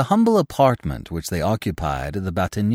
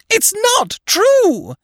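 piercing, very high slow scream; 5 dB louder, much slower than usual. A narrator's reading. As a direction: shriek the denial, dragging it out. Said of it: "she screamed".

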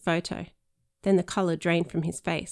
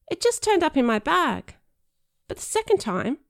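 higher, hesitant, flustered explanation; nervous boy; 12 semitones higher, 4 dB louder than usual. Pretend. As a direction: stammer it out nervously. Danny sweating in role.

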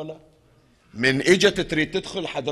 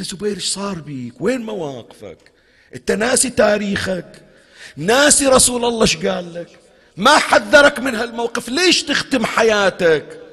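higher-pitched, pleading reproach, building louder; acted reproach; 6 semitones higher, 3 dB louder than usual. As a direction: confront an old companion with rising urgency. Imam reproaches Zubayr in retold dialogue. (عتاب بصوت يعلو تدريجياً)

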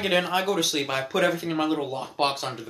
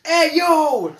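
excited greeting; 12 semitones higher, 10 dB louder than usual. Retold or imitated. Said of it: "I was like".

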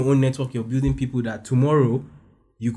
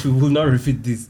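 louder, laughing playful echo; laughing repeat; 4 dB louder than usual. Shown as a demonstration. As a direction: repeat it through a laugh, teasing. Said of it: "I hope you guys are writing these points down".

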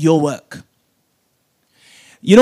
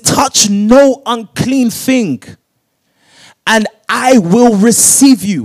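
slow, louder, drawn-out preaching of the verse; 4 semitones higher, 7 dB louder, slower than usual. Acted. Preacher proclaiming God's words to the congregation, slow and loud. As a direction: lean on every word like a pronouncement.

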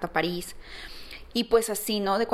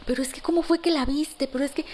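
astonished husband; 6 semitones higher, faster than usual. Retold or imitated.

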